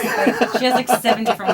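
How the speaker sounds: gushing through laughter; laughing. Real, not acted. Gushing about co-star, laughing.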